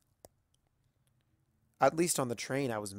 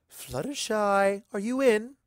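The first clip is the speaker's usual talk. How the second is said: higher, louder sing-song call into the shop; Rarity calling out; 9 semitones higher, 6 dB louder, slower than usual. A narrator's reading. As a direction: call for the shopkeeper across the room. Calling into the boutique.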